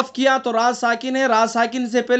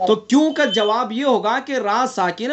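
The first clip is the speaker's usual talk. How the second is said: usual speaking voice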